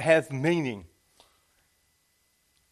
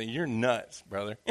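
mild mock scoff; 5 semitones lower, 6 dB quieter, slower than usual. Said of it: "You say".

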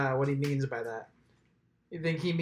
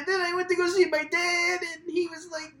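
very high cartoon turtle voice; squeaky teen turtle rambling; Ninja Turtle voice; 13 semitones higher than usual. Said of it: "He's like".

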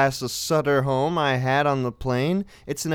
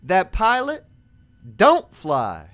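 telling a joke; 9 semitones higher, 4 dB louder, slower than usual. Retold or imitated. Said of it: "I tell them all my joke".

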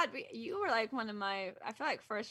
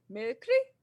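lightly voiced idol chirping "Merry Kri" a bit louder; a hint of an idol's playful holiday greeting; cute pop idol greeting; 3 semitones higher, 7 dB louder than usual. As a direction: imitate a cute boy-band holiday wish. Quoting the singer's cute greeting.

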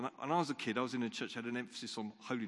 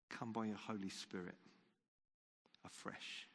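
quiet prayer; 3 semitones lower, 5 dB quieter than usual. Acted. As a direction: pray gently, almost under the breath. Leads a quiet closing prayer.

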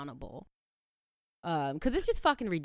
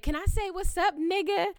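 lightly voiced, high-pitched pleading roommate; imitated drunk roommate; 11 semitones higher than usual. Drunk roommate asks her for permission, lightly mimicked high plea. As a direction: mimic her asking in a higher voice. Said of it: "she was drunk as shit".

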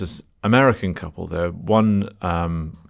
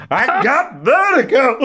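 high, loud, drawn-out mock wail; hammed-up shriek, imitating a sick woman; screamed mock impression; 5 dB louder, slower than usual. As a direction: scream out the seasick American impression. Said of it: "I just screamed".

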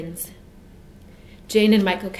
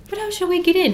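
put-on a child's voice pitched much higher and louder; anxious child; 12 semitones higher, 6 dB louder, faster than usual. A narrator's reading. Michael asks Jane at the zoo gate, high and anxious. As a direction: squeak it out, worried about getting in.

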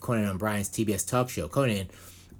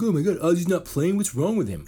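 higher, louder whiny fan; mock fan whine; 6 semitones higher, 5 dB louder than usual. Mocking fans wondering why [name] is missing, whiny. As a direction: play a clueless fan fretting. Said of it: "they'd be like".

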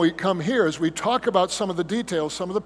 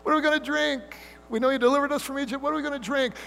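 put-on high, whiny childish complaint; whiny children; 7 semitones higher, much faster than usual. Israelites griping to Moses in the desert, high childish whine. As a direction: whine it like a spoiled kid. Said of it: "they sounded often like children".

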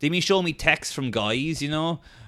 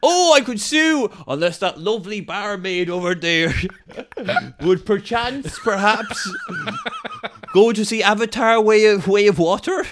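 hammy con artist; 6 semitones higher than usual. Pretend. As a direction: play the sly fake victim. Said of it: "I'm like".

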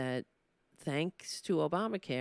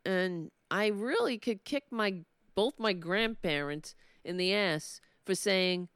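normal talking voice

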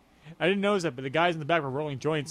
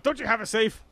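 usual speaking voice

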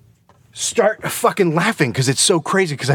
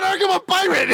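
pirate voice; 12 semitones higher than usual. Retold or imitated. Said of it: "And I said".

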